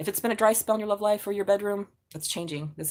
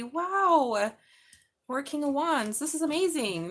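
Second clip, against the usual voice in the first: much higher, delighted exclaiming; thrilled; 7 semitones higher, slower than usual. Real, not acted. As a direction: gush over the card. Delighted by the drawn card.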